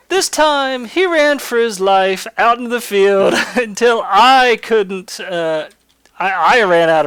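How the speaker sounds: loud and high, building excitement; excited. Real, not acted. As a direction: shout it out, thrilled by the memory. Excited climax of fight story.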